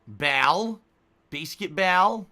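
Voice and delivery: "Ball" as "bal", "Basketball" as "bay-skit-bal"; loud, questioning, front "a" in "Ball"; odd "ball" pronunciation. Shown as a demonstration. Streamer quizzing viewers with silly versions, loud "bal". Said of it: "How do you say it?".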